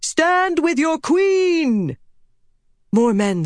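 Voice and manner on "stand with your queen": high, drawn-out battle call; rallying cry. A narrator's reading. Queen urges fleeing men to stand, rallying cry. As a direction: call out slowly, pitched right up. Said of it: "Elaine cried".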